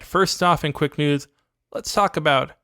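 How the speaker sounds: normal talking voice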